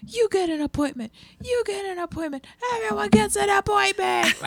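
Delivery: high, building to a shout of Everyone gets an appointment; mock Oprah giveaway. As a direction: hand out appointments like a game-show host. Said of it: "I like to be like".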